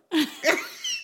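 high laugh; high burst of laughter. Real, not acted. Telling a friend the story, bursting into high laughter. Laugh after retold quote.

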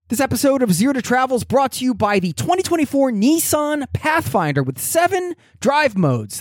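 upbeat sponsor read voice. Acted. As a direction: read it like a podcast ad. Sponsor ad reads.

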